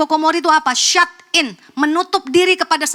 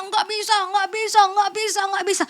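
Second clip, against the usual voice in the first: put-on higher-pitched, repetitive "can't" of a stressed child; 8 semitones higher than usual. Preacher imitates stressed child to congregation, stressed and high-pitched. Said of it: "stres selalu ngomongnya".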